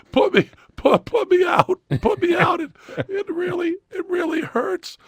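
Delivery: high, pained begging; crying plea. Retold or imitated. Patient begging the surgeon team, crying plea. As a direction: plead to be knocked out. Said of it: "And I was crying".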